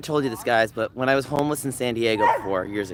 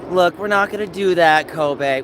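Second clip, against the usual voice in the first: louder, higher no-nonsense warning; firm scold; 5 semitones higher, 5 dB louder than usual. Real, not acted. Owner scolding dog Kobe, loud and firm. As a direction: stop the dog with a raised voice.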